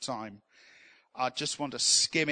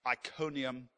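usual speaking voice

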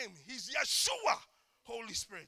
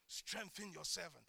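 hushed, humble plea; soft prayer; 9 semitones lower, 18 dB quieter than usual. Shown as a demonstration. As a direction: pray it low and humble, almost under the breath. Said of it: "I like to say it like that".

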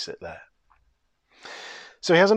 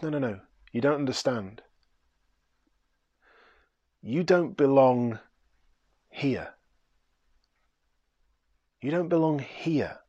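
softer, tender, unhurried; gentle correction; 3 dB quieter than usual. Acted. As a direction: ease his shame kindly. Bridegroom gently corrects him.

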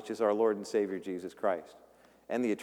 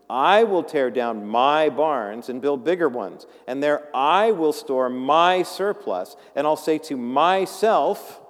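slow, louder emphatic reading of the rich man; slow scripture reading; 5 dB louder, slower than usual. Acted. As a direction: lean on each word of the rich fool. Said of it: "reading the passage".

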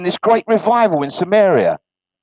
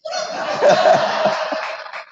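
high wheezy laugh. Real, not acted. Laughs at own joke.

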